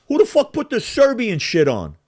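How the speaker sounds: loud, high outraged demand; retold flipping out. Rival in a bar hears the Serbian song, flipping out. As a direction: yell it across the bar. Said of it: "this motherfucker flips and goes".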